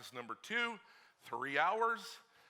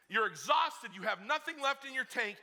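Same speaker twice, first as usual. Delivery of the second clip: ordinary talk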